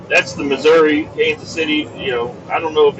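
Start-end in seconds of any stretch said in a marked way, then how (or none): none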